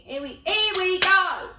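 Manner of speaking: loud, high, drawn-out exasperation; exasperated here-we-go. Retold or imitated. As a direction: groan it slowly and loudly. Exasperated at being lectured.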